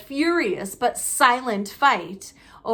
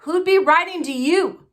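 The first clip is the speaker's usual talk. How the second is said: mocking, louder sneer; 7 semitones higher, 6 dB louder than usual. Uncle mocking Harry about his letter, sneering. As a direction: sneer down at the boy. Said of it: "sneered Uncle Vernon".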